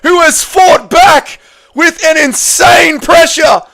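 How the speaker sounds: high, loud, racing fight call that keeps climbing; excited play-by-play. Acted. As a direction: call the final round like it's the fight of your life. Live play-by-play of fight finish.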